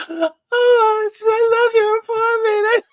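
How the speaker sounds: high, loud delighted squeal; thrilled buyer squealing over the apartment; gushing buyer. Retold or imitated.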